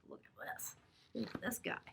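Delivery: whisper. Real, not acted. Aside about a picture.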